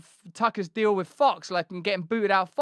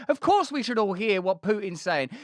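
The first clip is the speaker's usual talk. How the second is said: ordinary delivery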